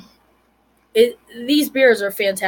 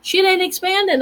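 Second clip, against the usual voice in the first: much higher, indignant at the photo; frustrated; 9 semitones higher than usual. Retold or imitated. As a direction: complain it won't zoom. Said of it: "I'm like".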